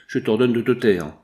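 usual speaking voice

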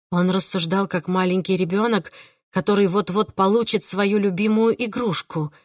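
ordinary delivery, nothing marked

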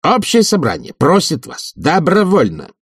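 high, insistent official demand; officious committee head. Acted. Committee head asks professor to give up rooms, high and officious. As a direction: deliver the resolution in a high, emphatic voice.

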